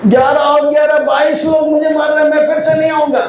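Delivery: high-pitched mock complaint; person kicked as football. Listener put in football's place, protesting in a high wail. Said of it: "तो आप क्या बोलोगे".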